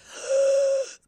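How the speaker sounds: sharp, wheezy gasp for air; wheezing gasp. Retold or imitated. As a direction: gasp in like you can't breathe. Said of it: "wheezing like that".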